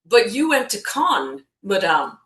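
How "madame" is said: normal talking voice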